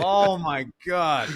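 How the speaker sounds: high, loud, incredulous cry; can't believe what he just heard; disbelief. Real, not acted. Guest admits he never saw the movie, loud disbelief.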